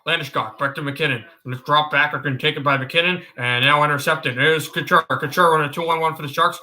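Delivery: low, running hockey play-by-play; Mark Moser. Acted. Streamer doing a requested broadcaster impression, low steady play-by-play. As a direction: keep the call low as the passes move. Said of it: "you want me to do Mark Moser".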